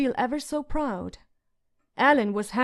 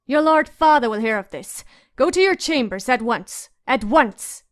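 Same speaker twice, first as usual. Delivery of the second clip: stern shout; 7 dB louder than usual. A narrator's reading. Septa orders the girls out.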